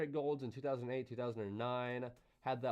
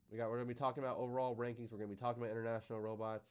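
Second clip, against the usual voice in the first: fast; 4 dB quieter, faster than usual. Real, not acted. Rushing before break.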